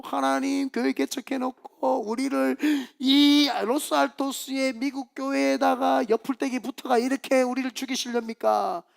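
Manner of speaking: slow, droning mock lament to God; imitated reproachful prayer on a sustained note; complaining prayer. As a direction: whine it out as a drawn-out prayer. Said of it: "근데 입으로".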